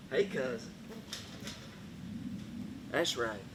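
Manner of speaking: quietly greeting a buzzard as cousin; low greeting. Acted. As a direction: murmur a greeting like to kin.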